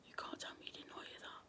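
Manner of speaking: fast, barely voiced whisper; terrified whisper. Real, not acted. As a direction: hiss it out in fear of the noise. Reacts to a noise, terrified.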